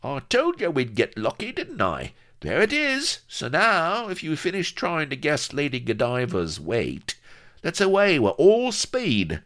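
high, excited crowing; gloating. Acted. Avery privately celebrates his lucky find, gloating and excited. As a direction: crow with glee, building to a loud rallying call. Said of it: "inwardly he was gloating".

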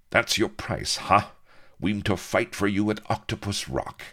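rasped pirate scorn; rasping. A narrator's reading. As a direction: rasp it out with scorn. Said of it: "he rasped".

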